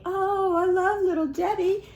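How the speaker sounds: high, gushing and excited; gushing fan. Retold or imitated. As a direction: gush like a devoted fan. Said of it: "friends who were telling me".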